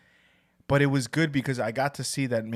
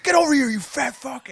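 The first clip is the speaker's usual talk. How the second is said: high, louder mock yell of a bullying boss; 14 semitones higher, 7 dB louder than usual. Imitating his boss Kenny yelling insults at him, high and loud. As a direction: bark it like a loud brute calling you over. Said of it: "He's like".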